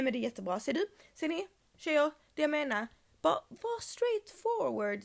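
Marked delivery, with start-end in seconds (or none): 3.2-5.0 slow, higher and insistent; emphatic advice. Real, not acted. Advising girls directly. (långsamt och eftertryckligt)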